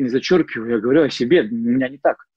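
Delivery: ordinary delivery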